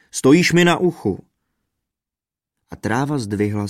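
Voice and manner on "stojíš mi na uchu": loud, raised protest; indignant shout, pitched up; roared complaint. A narrator's reading. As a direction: bellow it in pain. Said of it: "zařval bubeník".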